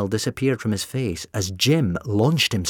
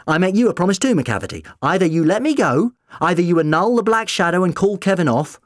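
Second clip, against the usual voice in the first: raised, high, insistent declaration; forceful ultimatum; 9 semitones higher, 5 dB louder than usual. A narrator's reading. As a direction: lay down the terms loudly. Doctor delivers an ultimatum.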